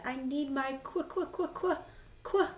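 high plea into rapid stammer; acted freezing queen; shivering with cold. A narrator's reading. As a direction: stutter helplessly on the last word. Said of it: "so cold she couldn't finish her sentence".

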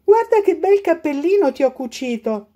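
lightly voiced granny, high and proud; granny showing her handmade hat; grandma's voice. A narrator's reading. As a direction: show off the little hat sweetly. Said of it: "diceva la nonna".

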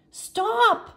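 high, loud shout. A narrator's reading. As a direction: yell at the spinning treehouse. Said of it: "Jack shouted at the treehouse".

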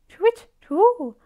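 high, loud owl call; ringing high-pitched owl cry; owl hoot. A narrator's reading. Owl's hooting call in story.

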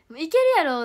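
mock overconfident boast; confident loud boast. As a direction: declare it loudly like you're sure. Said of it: "って言っていつもあかんねんな".

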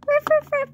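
high yappy ruff ruff; playful puppy yaps; puppy barking. Pretend. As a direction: bark it like a small puppy. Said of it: "which goes".

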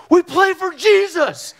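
high, loud, drawn-out battle cry; retold shout. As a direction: shout it back across the field. Friend shouts back across the field.

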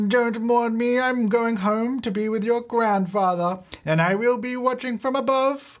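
hammed-up old lady on her deathbed; old grandma voice. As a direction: play the frail grandmother's last words. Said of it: "I don't know why I'm talking like this now".

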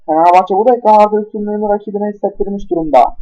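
ordinary talk, nothing marked

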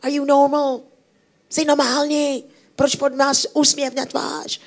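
high mocking voice of suspicious locals; grumpy townsfolk. Grumpy townspeople mocking Mister Happy's smile, high and mocking. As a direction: sneer it up high at the smiling stranger. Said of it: "And he's like".

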